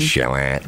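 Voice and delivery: Charlotte as "shar-LOT", stressed at the end; local Rochester pronunciation. Shown as a demonstration. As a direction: say Charlotte the local way, low-pitched. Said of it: "we like to say things like".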